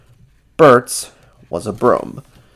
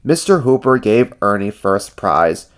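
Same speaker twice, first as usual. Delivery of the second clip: usual speaking voice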